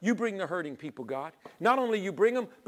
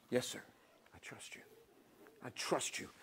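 hushed whisper of trust; soft whisper, yielding to God; whispered surrender; 9 semitones lower, 14 dB quieter than usual. Retold or imitated. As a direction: breathe the words as a surrender. Believer answering God.

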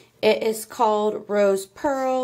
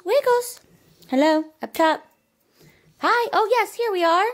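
put-on higher cooing baby talk to a dog; high pet talk; 10 semitones higher, 4 dB louder than usual. Owner greeting her pug Wiggles, high cooing pet talk.

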